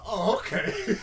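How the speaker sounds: high, loud laughing "oh okay"; teased friend laughing back. Retold or imitated. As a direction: giggle it out. Mock reply amid teasing laughter.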